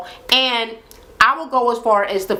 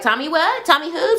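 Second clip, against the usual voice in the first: higher, laughing play-dumb denial; mock innocent; 8 semitones higher than usual. Man denying he knows anyone, higher and playful. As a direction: feign not knowing him. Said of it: "And Tommy like".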